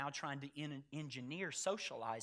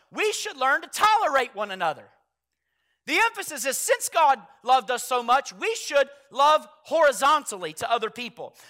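rising, louder preaching to the church; full-force preaching; 9 semitones higher, 19 dB louder than usual. Acted. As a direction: preach it, driving each point home.

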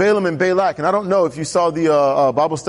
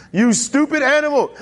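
pitched-up exasperated retort at a donkey; hammed-up scolding, higher; mock angry insult; 8 semitones higher than usual. Preacher voicing Balaam scolding his donkey, mock angry. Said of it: "Matthew's sanctified church version".

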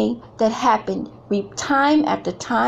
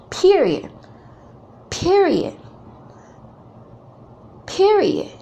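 each period higher and more forceful; emphatic period; 9 semitones higher, slower than usual. Real, not acted. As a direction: punch every period, climbing higher. Emphatic repeated period, rising.

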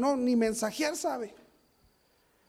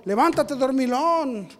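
loud, higher shout, slowing on the last words; captain's shout; 7 semitones higher, 15 dB louder, slower than usual. Acted. Ship captain shouting at sleeping Jonah, loud. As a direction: bellow at a sleeping Jonah to wake and pray.

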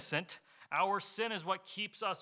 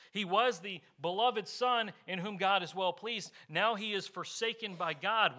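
normal talking voice

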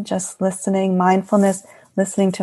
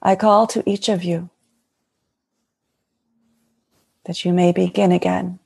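lightly voiced serene goddess speaking; a hint of a blessing divine mother; channeling Mary Magdalene. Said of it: "And the Magdalene would like to speak".

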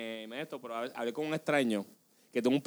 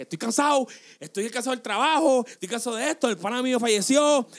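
higher, louder exasperated outburst; retold outburst; 9 semitones higher, 7 dB louder than usual. At work, exploding about being tired of everything, an exasperated retold outburst. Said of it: "yo tuve un momento que exploté y dije".

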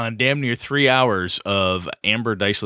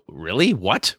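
usual speaking voice

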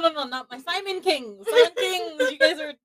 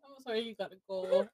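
ordinary talk

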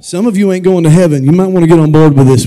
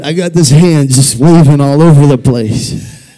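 ordinary talk, nothing marked

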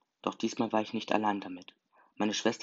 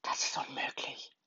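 slow, voiceless whisper; shocked whisper; 9 dB quieter than usual. A narrator's reading. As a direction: whisper it slowly into an ear. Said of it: "flüsterte mir ins Ohr". (langsames, ungläubiges Flüstern)

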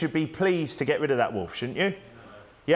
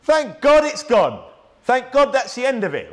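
much higher, louder joyful relief; relieved cheer; 8 semitones higher, 5 dB louder than usual. Retold or imitated. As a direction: celebrate like sheep glad the wolf is gone. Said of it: "They're going".

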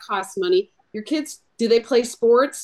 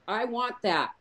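ordinary talk, nothing marked

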